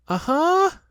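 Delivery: high, loud squeaky aha; a little pig trying to sound bold; Piglet's high aha; Piglet's triumphant aha. A narrator's reading. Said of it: "said Piglet again".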